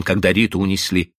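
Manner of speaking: plain reading voice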